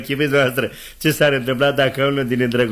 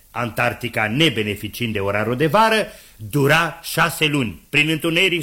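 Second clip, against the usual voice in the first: usual speaking voice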